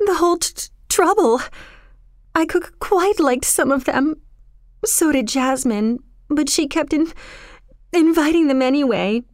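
put-on high, stammering, tearful girl; upset girl's voice, breaking off and restarting; starting to cry. A narrator's reading. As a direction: stumble over words through acted tears. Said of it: "Kismeen began to cry".

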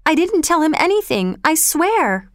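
put-on terrified boy swearing through tears; crying. A narrator's reading. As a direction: plead through sobs.

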